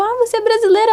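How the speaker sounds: amazed, pitched well up; retold surprise. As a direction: act out spotting a fellow Brazilian. Retold surprised discovery of compatriot.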